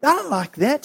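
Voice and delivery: acted indignant protest with stumbling starts; angry and upset. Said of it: "And he's angry and upset".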